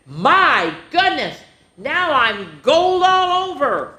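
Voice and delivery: high, slow astonished; amazed. A narrator's reading. Pointy discovering he is all gold, high and amazed. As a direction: marvel at it slowly.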